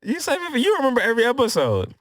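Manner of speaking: loud, high-pitched protest; playful accusation. Real, not acted. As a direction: call out the friend, high and loud. Playfully accusing friend of lying.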